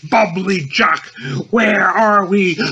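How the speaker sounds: gobble it out like a turkey. Acted. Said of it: "He gobbles gluttonously with turkey wattles".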